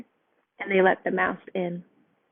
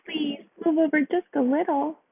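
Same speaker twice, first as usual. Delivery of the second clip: pleading sparrow; 7 semitones higher than usual. A narrator's reading. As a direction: coax them gently.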